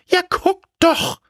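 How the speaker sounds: very high-pitched character voice; unknown voice. A narrator's reading. Scarecrow asks the tailor who he is, very high. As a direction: ask in a squeaky-high put-on voice. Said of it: "sagte die Stimme".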